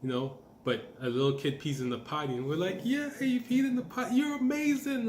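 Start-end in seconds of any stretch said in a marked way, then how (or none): 2.8-5.1 put-on high, loud sing-song praise for a toddler; gushing baby talk. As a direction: gush over the little one like a proud parent.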